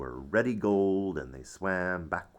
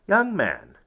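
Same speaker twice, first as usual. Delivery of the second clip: very mad mother; 6 semitones higher, 9 dB louder than usual. Acted. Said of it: "only calls me young man if she's very, very mad".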